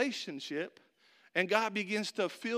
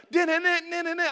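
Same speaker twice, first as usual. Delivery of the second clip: hummed highlight fanfare; 10 semitones higher, 8 dB louder than usual. Retold or imitated. Imitating highlight music.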